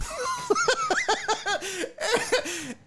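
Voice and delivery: long high-pitched real laughing fit; cracking up. Real, not acted. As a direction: burst into helpless high laughter. Cracking up at own story.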